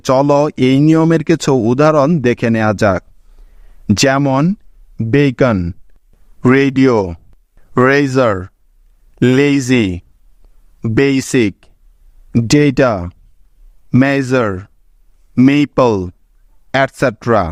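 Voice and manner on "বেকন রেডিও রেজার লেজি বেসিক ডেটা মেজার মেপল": বেকন and রেডিও said slowly, each first vowel a long "ei"; long a sound demo. Shown as a demonstration. Teacher reading example words to students, careful long "ei" vowel. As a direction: sound out each word with an open "ei" syllable. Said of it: "ভাওয়েলটির sound সবসময় এই এর মতো হয়".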